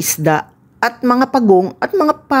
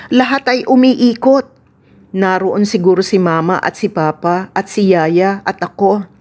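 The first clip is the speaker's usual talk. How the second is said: ordinary delivery, nothing marked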